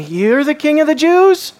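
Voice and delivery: mocking. Pilate mocking Jesus before him, sneering question. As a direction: scoff at the prisoner. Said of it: "In saying it mockingly".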